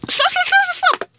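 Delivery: shrill high stop stop; high shriek. Real, not acted.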